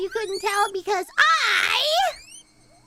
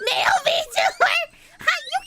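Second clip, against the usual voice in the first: high, loud disbelieving cry; shocked shout; 10 semitones higher, 5 dB louder than usual. Real, not acted. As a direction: blurt it out in outrage. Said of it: "shocked reaction".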